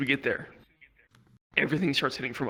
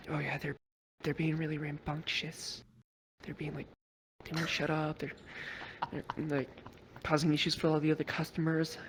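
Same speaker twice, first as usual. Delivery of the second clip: hushed waitress; 2 semitones higher, 8 dB quieter than usual. Retold or imitated. Waitress quietly complains to the police, hushed. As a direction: murmur the complaint behind their backs.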